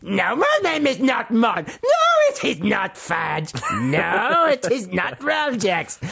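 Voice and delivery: high, slow, triumphant gnome denials; hammed-up refusing little gnome. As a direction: gloat each "no" in a high imp voice. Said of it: "it's a reductive accent I'm doing".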